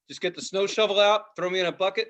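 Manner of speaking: normal talking voice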